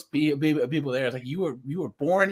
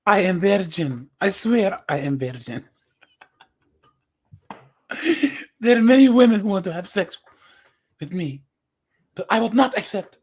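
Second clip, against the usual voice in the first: put-on Turkish accent; 5 semitones higher, 5 dB louder than usual. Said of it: "almost going with the Turkish accent there".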